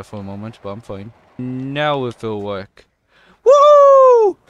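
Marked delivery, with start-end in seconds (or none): 3.4-4.4 very high, loud drawn-out woo; triumphant whoop. Real, not acted. Gamer celebrating a landed trick, triumphant whoop. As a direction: whoop at full volume.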